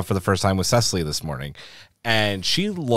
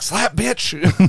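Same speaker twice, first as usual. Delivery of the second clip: high, playful outburst; mock slap cry; 8 semitones higher than usual. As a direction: shout it like a comic slap. Acting out urge to slap Veruca.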